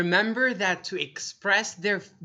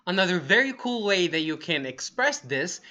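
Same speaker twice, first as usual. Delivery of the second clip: normal talking voice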